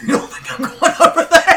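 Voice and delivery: high, loud, laughing through the question; laughing protest. Real, not acted. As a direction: laugh it out in disbelief. Laughing defense of choice.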